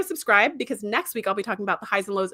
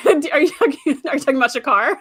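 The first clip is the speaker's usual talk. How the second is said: laughing; 4 semitones higher, 5 dB louder than usual. Real, not acted. Laughing at a chat comment.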